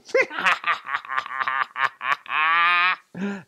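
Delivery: a slow self-satisfied chuckle; smug villain laugh. A narrator's reading. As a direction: laugh like a bully pleased with himself. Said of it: "and he'd sit there going".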